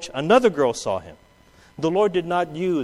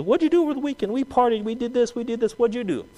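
cheerful classmate; 7 semitones higher, faster than usual. Acted.

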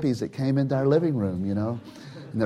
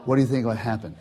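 usual speaking voice

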